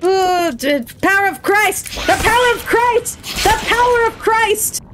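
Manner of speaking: high, insistent exorcist's chant; mock exorcism cry. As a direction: cry it out high to repel demons. Mock exorcism at game demons.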